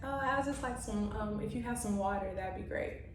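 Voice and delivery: very soft, sweet and gentle; little dainty voice. Retold or imitated. Asking her date for water, in a dainty soft voice. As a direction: put on a dainty, polite little hush. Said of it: "in my little dainty voice".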